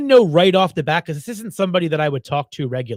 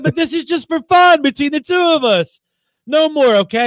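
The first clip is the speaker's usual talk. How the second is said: put-on higher, louder coaxing girl voice; mock pleading scammer; 9 semitones higher, 5 dB louder than usual. Scammer coaxing him to keep chatting, mock pleading. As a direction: coax him, playing the scammer. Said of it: "She said".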